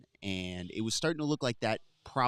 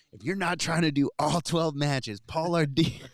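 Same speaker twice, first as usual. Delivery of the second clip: loud, raised, drawn-out challenge; retold call-out; 6 semitones higher, 6 dB louder, slower than usual. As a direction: call him out slowly, pitched up and loud. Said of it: "calls Paul out".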